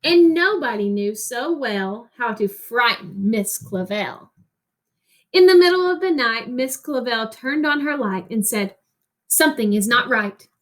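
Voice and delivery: put-on reading a picture book to children, rhythmic and lilting; expressive read-aloud narration; story reading. Teacher reads picture book aloud.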